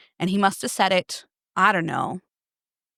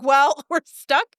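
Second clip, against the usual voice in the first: laughing; 6 semitones higher, 4 dB louder, slower than usual. Retold or imitated. Laughing at husband's plea.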